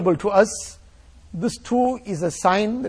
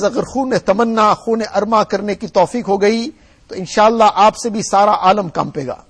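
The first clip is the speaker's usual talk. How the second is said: rapid text recitation; 3 semitones higher, 5 dB louder, much faster than usual. Acted. Reads Urdu text before translating.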